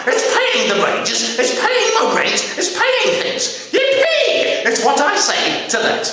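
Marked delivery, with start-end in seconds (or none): 3.7-4.6 high, playful whoop of joy; hammed-up glee; gleeful cheer. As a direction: cheer it high like payday has come. Cheering about being paid.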